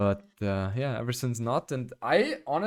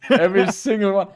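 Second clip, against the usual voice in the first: laughing; 7 semitones higher, 8 dB louder than usual. Real, not acted. Laughing reply to teasing.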